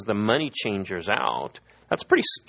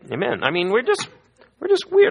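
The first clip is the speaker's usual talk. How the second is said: rising exclamation; 12 semitones higher, 4 dB louder than usual. Real, not acted. Preacher cracks up the congregation with a high loud punchline. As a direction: pitch climbs high and loud on weird.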